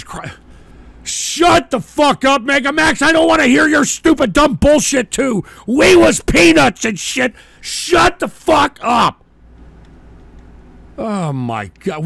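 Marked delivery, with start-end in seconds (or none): 1.0-5.4 screaming loud and high; furious shout. Real, not acted. Raging at another player. 5.7-7.3 loud mocking repeat; mocking shout. Mocking the player's words. 7.6-9.2 screaming loud and high; furious shout. Real, not acted. Raging at another player.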